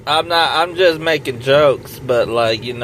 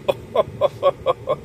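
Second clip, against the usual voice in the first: mocking laugh; 5 semitones higher than usual. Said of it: "she would be like".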